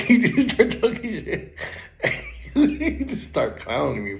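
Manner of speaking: slow, cracked-up retelling; laughing while talking. Real, not acted. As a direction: squeeze the story out through real laughter. Laughing at being clowned.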